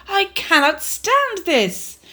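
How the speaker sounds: high and slow, worn out; Mister Bear sick of the noise; exasperated Mister Bear. Acted. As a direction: drag it out in despair. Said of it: "said Mister Bear".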